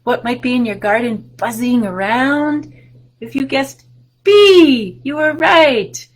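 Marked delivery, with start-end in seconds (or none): 3.2-6.1 slow, louder, higher praise; cheerful praise. Acted. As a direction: reward the guess with delight. Praising children's guess.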